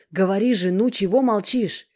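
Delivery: loud, high, impatient urging. Acted. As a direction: push her to speak now. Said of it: "торопила она".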